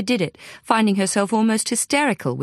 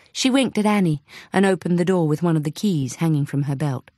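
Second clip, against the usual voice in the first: ordinary delivery, nothing marked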